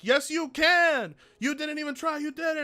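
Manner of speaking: high-pitched, hyped-up coaching voice; mock trainers cheering. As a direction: play the pushy trainer egging him on. Trainers urging him at deadlift.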